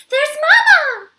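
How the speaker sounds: high, loud, drawn-out joyful cry; baby gorilla spots her mother, crying out excitedly. A narrator's reading. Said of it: "Kudzu cries".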